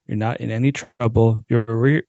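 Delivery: plain reading voice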